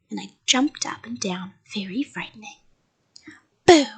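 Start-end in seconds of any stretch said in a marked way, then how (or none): 3.7-3.9 loud, sudden scare; shouted boo. A narrator's reading. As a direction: shout it to make them jump. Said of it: "I shouted".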